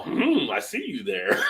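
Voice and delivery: laughing mock dub; mock kung fu dub. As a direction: drawl it like a dubbed kung fu master. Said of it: "they talk like that".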